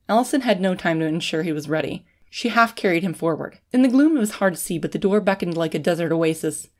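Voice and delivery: ordinary delivery, nothing marked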